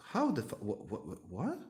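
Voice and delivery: stammering, mock-baffled reaction; retold bewilderment. Wife caught out by his understanding, stammering in bewilderment. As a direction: sputter it in mock disbelief. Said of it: "giving me these, these puppy eyes".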